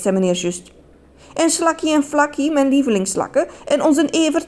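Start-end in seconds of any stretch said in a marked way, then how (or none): 1.3-4.5 high, gushing, swooning voice; flattered lady. A narrator's reading. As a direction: gush like a flattered sweetheart. Asks about her pets.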